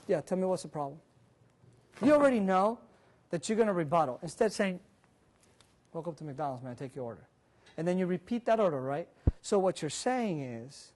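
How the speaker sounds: ordinary delivery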